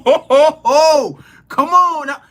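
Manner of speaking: high, loud excited whoop; real excitement at a telling card. Real, not acted. Reacting to the card drawn.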